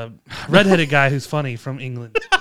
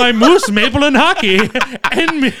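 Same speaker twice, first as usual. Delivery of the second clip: hammed-up Irish-Canadian lilt, higher and louder; mock Irish-Canadian accent; 7 semitones higher, 3 dB louder, slower than usual. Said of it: "mock Irish-Canadian bit".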